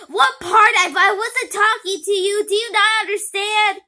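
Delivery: very loud, high exasperated yelling; furious shout. Acted. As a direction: blow up at the kid. Villain exasperated at kid.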